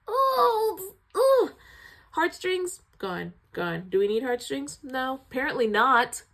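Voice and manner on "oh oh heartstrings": high, pained wailing oh; anguished cry. Real, not acted. Host moved by the mother scene, pained high wail. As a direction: let the heartbreak break out in a cry.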